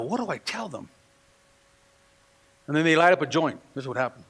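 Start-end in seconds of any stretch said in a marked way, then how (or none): 0.0-0.9 soft, low, breathy self-question; a quiet, anxious question to himself; worried inner question. Retold or imitated. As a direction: ask it under your breath, unsure. His worried thought at home.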